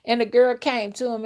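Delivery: ordinary talk, nothing marked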